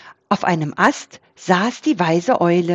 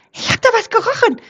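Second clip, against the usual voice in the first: very high, rapid raccoon voice; squeaky, hurried shout; raccoon calling out; much faster than usual. A narrator's reading. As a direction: blurt it out high and fast. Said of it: "rief Waschbär Schupp".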